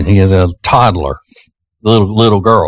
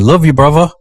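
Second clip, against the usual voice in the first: higher, playful attempt at a British accent; imitated British accent; 7 semitones higher than usual. Host imitating the toddler copying the British dad, British accent. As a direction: mimic a kid trying to sound British. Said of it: "trying to get some of that British accent".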